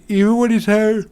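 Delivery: words stretched by a big yawn. Real, not acted. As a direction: talk through a yawn. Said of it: "I'm sorry about all the yawning".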